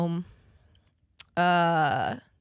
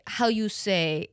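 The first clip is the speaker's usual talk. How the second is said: slow, hammed-up French accent; mock French accent; slower than usual. Mocking people who fake French accents, slow cheesy French accent. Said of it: "do a French accent".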